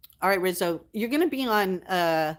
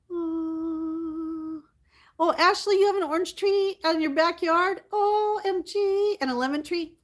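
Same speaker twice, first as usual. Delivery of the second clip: much higher, squealing excitement; delighted gush; 9 semitones higher than usual. Real, not acted. Thrilled to hear Ashley has an orange tree, squealing. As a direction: gush over the news like a thrilled fan.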